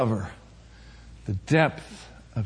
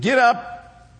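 proclaiming prophet; 10 semitones higher, slower than usual. Retold or imitated. Prophet's proclamation voiced in sermon.

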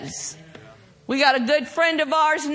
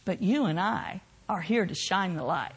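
lower, softer preaching, drawing them in; soft intimate preaching; 7 semitones lower, 7 dB quieter than usual. Real, not acted. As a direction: hush it down and plead gently.

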